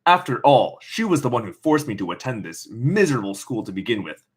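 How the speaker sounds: ordinary delivery, nothing marked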